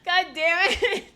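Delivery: high loud drawn-out groan; slow whining cry of frustration; exasperated wail; frustrated wail. Real, not acted. Frustrated reaction.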